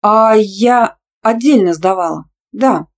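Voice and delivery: triumphant. A narrator's reading. Student inventing an excuse to her angry teacher, triumphantly. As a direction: draw it out triumphantly, making it up. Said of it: "с торжеством глядя в его гневные глазки".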